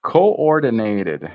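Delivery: slow, loud syllable-by-syllable coordinated; coordinated with the N pushed twice; syllable drill. Shown as a demonstration. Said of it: "We push into that N twice".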